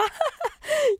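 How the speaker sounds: a short high burst of laughter; real amused laugh; bright laugh. Real, not acted. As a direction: laugh at your own joke. Host laughs at own remark.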